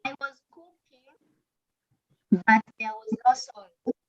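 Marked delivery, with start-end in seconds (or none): 0.0-1.0 very quiet student voice; barely audible. Real, not acted. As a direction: speak far too softly to be heard. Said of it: "I can't hear you".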